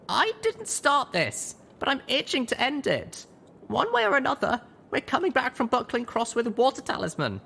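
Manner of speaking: put-on grim young mare. A narrator's reading. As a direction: promise it through anger. Littlepip vowing.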